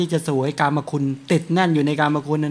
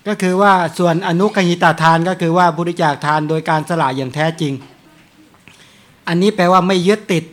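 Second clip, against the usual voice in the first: louder, emphatic Dhamma teaching; forceful preaching; 2 semitones higher, 8 dB louder than usual. Acted. Monk preaches non-attachment to laypeople, loud and emphatic. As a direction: drive home the point to the congregation.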